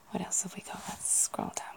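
ordinary delivery

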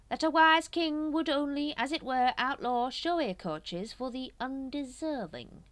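a silky schemer steering the room; Supreme Grand Master voice. A narrator's reading. Grand Master nudging the brethren, sly and measured.

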